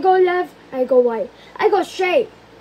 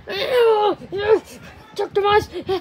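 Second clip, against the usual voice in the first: ordinary talk, nothing marked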